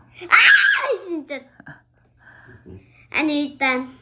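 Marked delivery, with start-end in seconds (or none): 0.0-1.0 loud, high laughing cry; laughing shout. Real, not acted. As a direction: burst out laughing loudly.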